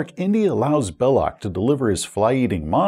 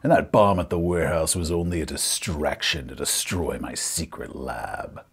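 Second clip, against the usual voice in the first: low, soft villain voice sinking lower; gloating villain; 9 semitones lower, 6 dB quieter than usual. Acted. Villain reveals his distraction to the players, low gloating. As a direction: unveil the plot low, slowing to a menacing close.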